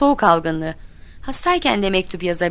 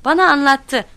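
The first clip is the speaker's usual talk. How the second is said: high, loud little brag; proudly in the know; boastful; 8 semitones higher, 8 dB louder than usual. A narrator's reading. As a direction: show off knowing a secret. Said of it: "sırrını bilmenin övüngenliğiyle".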